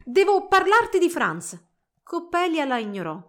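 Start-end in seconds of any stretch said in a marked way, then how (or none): 0.0-1.6 high, loud, deliberate appeal; urgent appeal. A narrator's reading. Girl confronting her rival about her sweetheart, urgent. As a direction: press her slowly and urgently.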